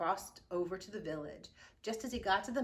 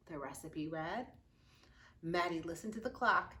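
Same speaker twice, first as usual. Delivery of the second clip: ordinary delivery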